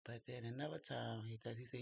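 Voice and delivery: ordinary talk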